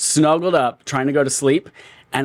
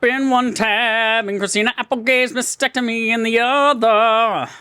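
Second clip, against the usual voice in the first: mock dramatic announcer; 8 semitones higher than usual. Mock-serious podcast opener.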